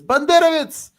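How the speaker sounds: high, loud accusing yell; accusing shout. Retold or imitated. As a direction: hurl the word at him as an accusation. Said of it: "he says".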